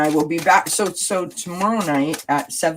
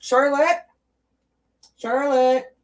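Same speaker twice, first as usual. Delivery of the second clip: calling out; 11 semitones higher, slower than usual. Real, not acted. Calling her pet from afar.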